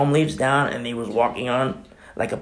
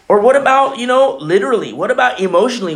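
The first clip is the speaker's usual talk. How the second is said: preaching fervor; 9 semitones higher, 5 dB louder, faster than usual. Real, not acted. Preaching at full force.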